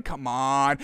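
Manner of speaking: ordinary talk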